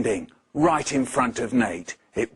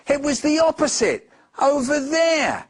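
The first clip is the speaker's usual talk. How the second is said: much higher, loud urgent pointing; excited child; 11 semitones higher, 4 dB louder than usual. Acted. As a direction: point it out to mum, all worked up. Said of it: "cried Nate".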